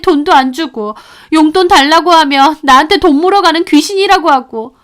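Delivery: put-on high, tearful pleading child; a granddaughter crying out her hurt. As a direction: burst out sobbing the truth. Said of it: "시연이가 갑자기 울음을 터뜨렸죠".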